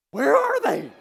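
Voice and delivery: very loud, high comic panic; spooked outburst. Retold or imitated. Couple told unseen angels are here, spooked shout. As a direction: yell it out in exaggerated alarm.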